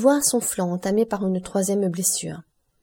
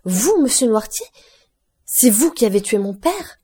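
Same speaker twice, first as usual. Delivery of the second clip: horrified disbelief; 3 semitones higher, 5 dB louder than usual. A narrator's reading. Franz learns Noirtier killed father.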